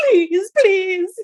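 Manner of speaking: hammed-up whiny begging; Conrad. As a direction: squeal it like an exaggerated beggar. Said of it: "Conrad just being like".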